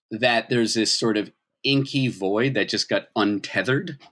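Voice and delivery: ordinary talk, nothing marked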